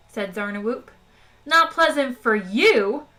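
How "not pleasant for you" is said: slow, loud indignant bawl. Acted. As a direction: bellow it back, outraged. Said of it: "bawled Zaphod".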